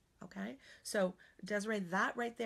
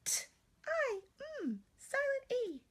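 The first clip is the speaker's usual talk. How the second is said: put-on much higher child sounding out letters; teacher voicing her student, much higher; little student voice. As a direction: sound out like a young pupil. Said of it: "the student says".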